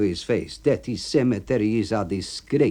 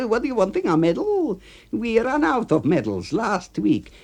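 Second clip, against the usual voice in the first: much higher mocking voice; mock him from up high; sarcastic A B; 13 semitones higher than usual.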